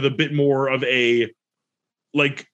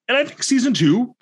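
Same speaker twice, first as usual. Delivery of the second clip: high, cracking voice; 8 semitones higher than usual. Real, not acted. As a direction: let the voice crack as it climbs. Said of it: "my voice is cracking here".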